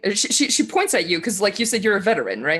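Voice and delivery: normal talking voice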